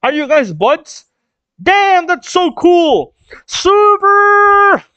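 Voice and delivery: very high, loud cartoon enthusiasm; Frankie's excited outburst. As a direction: whoop and stretch the final word. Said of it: "And Frankie goes".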